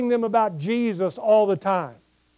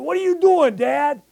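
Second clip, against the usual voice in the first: higher, louder, faster daughter's protest; mock daughter complaining; 6 semitones higher, 3 dB louder than usual. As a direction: play the grown daughter scolding her dad, pitched up. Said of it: "And they'll".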